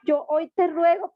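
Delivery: a loud, desperate retold prayer. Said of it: "yo le dije".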